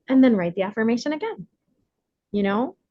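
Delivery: normal talking voice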